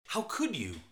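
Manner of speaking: dismayed, hurt reproach; shocked and upset at the dead fish; angry surprise. Shown as a demonstration. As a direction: let the disbelief sting a little. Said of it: "used to show anger and surprise".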